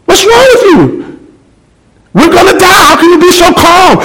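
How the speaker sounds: put-on a terrified passenger begging the calm girl to explain; panicked man. Man on the runaway train yells at the calm girl, panicked. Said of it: "he looks at her and said".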